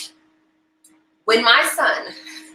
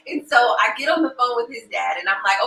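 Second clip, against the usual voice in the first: usual speaking voice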